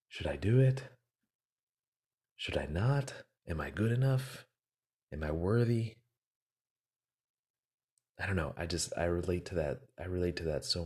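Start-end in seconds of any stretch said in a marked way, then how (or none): 0.1-6.0 higher, rising questions with pauses between; acted-out self-doubt. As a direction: voice the hesitant inner questions, pitch lifting on each. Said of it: "How many of us have been like".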